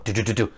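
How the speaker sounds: quick, low "do-do-do-do" knocks; imitated knocking. Knocking at the door of the room, imitated knocking. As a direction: rap out a little door knock by mouth. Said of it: "knock at the door".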